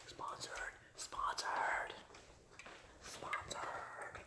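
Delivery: breathy whisper, drawn out. Shown as a demonstration. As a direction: whisper the word slowly. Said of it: "I don't wanna wake them up".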